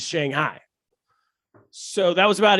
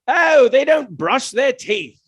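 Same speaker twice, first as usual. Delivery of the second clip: put-on slow, higher, louder British comic accent; 7 semitones higher, 5 dB louder, slower than usual. Old British comedian playing to expat crowd, in a British accent. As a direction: ham up the old British stand-up. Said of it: "shout out to your British accent".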